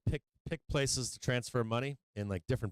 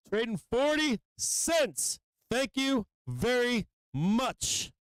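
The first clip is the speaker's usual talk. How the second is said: slow gleeful gloat; 10 semitones higher, slower than usual. Real, not acted. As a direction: savour the win, stretched out. Price hits target, gloats.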